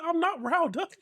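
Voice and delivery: father retelling his own denial; mock defensive. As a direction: deny it in a high indignant squeal. Father defensively quoting himself.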